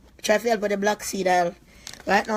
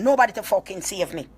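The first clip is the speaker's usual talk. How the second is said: ordinary delivery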